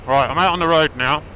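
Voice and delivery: calling out over the engine noise; loud over engine. Real, not acted. Greeting on road, engine running.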